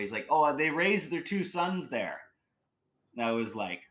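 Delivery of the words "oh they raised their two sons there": high, slow called-out line; a cabbie's high drawn-out call to a departing passenger; mock yell after someone. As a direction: call it after her as she walks off, pitched up. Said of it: "he yells as I'm walking away".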